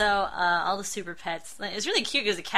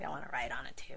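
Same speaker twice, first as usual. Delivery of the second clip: whispered confession; secret whisper; 7 semitones lower, 13 dB quieter, much faster than usual. Real, not acted. Confessing to a cohost she wants to ride big dogs, whispered.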